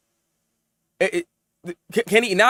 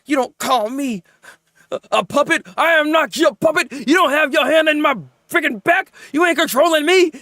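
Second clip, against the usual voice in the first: mock villain rant; 5 semitones higher than usual. Reviewer acting out the goon boss, indignant rant. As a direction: rant like the top dog goon. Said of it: "He was like".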